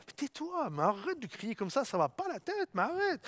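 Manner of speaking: a scolding crowd shushing him, lightly voiced; hushing crowd. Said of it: "le reprenaient pour le faire taire".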